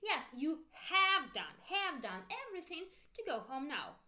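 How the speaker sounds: "have" stretched and punched; stress on HAVE. Shown as a demonstration. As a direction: model the emphatic HAVE for repeating. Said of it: "Repeat with me with intonation".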